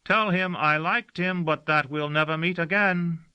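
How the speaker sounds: the servant calling a farewell message from the car; called out. Acted. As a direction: call it out in parting. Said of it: "called Gossagor".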